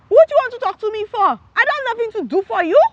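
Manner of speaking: high, fast defiant snap at God; defiant retort. Retold or imitated. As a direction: brush God off, annoyed. Arguing back at God.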